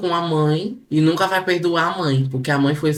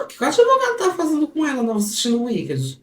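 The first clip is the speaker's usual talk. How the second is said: agudo exagerado, disparando a pergunta; fala citada encenada; 11 semitones higher, faster than usual. Acted. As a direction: leitura teatral em voz bem aguda e apressada. Lê fala indignada em voz teatral. (pergunta encenada, bem aguda)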